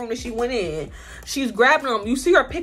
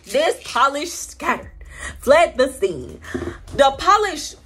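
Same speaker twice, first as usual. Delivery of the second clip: drawn-out words with dramatic pauses; dramatic slow-motion telling; much slower than usual. Real, not acted. Telling viewers the polish shattered, slow and dramatic. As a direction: stretch each word out for suspense.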